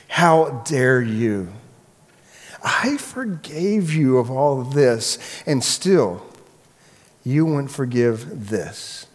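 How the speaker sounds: very slow, drawn-out rebuke; measured indignation, stretched and deliberate; appalled king. Acted. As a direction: weigh out each word in reproach. Repeated, more drawn-out rebuke.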